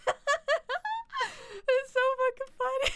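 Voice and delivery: very high, squeezed out through laughter; laughing hard. Real, not acted. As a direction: barely get it out for laughing. Cracking up at own laugh.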